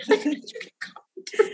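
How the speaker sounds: breathless, giggling whispered question; laughing whisper. Pretend. Cohost giggling about coffee getting through customs, whispered. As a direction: laugh it out under your breath.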